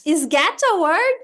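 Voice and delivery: slow, loud, swooping question; exaggerated classroom quiz. Shown as a demonstration. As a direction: ask the class with big mock wonder. Teacher models quizzing her pupils.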